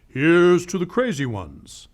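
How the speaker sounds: grand voiceover announcing the slogan. Retold or imitated. Quoting Apple's famous commercial to viewers, ad voiceover. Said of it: "the voiceover began".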